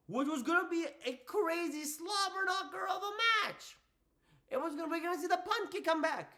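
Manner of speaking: high-pitched, over-excited fan hype; hyped. Real, not acted. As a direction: gush about the match that should have been. Fan hyping the lost match.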